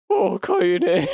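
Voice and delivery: brighter, lightly voiced grandma; first try at the tender grandma line; rejected old woman take. Shown as a demonstration. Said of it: "No, that's not as good".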